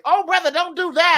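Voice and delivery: high, loud mock old-man warning. Old man stopping a brother's mistake, high hammed-up warning. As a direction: jump in and stop a young man, hammed-up.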